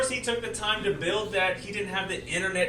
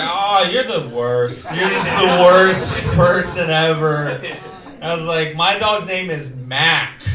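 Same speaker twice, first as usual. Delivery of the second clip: exasperated; 9 dB louder, slower than usual. Retold or imitated. Mocking lady's dog name.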